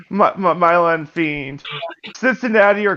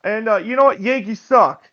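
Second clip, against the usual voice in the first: put-on higher, cocky Boston accent; 5 semitones higher than usual. Player defending Boston to a New Yorker, in a Boston accent. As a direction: brag like a Bostonian, R's dropped. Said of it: "you're not gonna do the accent?".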